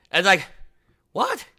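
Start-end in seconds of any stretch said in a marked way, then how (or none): 1.1-1.4 squeaky high disbelieving what; incredulous what. Retold or imitated. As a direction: react in shocked disbelief. Vendor's disbelief.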